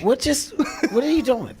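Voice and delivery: high, loud playful bewilderment; baffled protest. Pretend. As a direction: break in with incredulous alarm. Protesting at co-star's changed voice.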